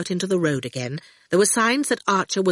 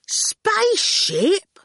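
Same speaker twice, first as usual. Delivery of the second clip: high, drawn-out question; astonished; 14 semitones higher, much slower than usual. A narrator's reading. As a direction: stretch it and swoop up in disbelief. Ginger stares, startled by the news.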